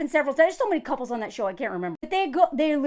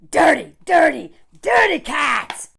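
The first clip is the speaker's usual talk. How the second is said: loud, punchy exclamations; mock scolding; 7 dB louder, slower than usual. Ranting about men's hygiene, mock scolding shout. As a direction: shout it in mock disgust, drawing out the end.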